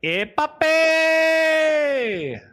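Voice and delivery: drawn-out, high, loud sultry call; ay papi. Pretend. Hamming up the pimp chat's reply, long high flirty call. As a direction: stretch it out like a flirty come-on. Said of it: "Ay, papi," it'd be better".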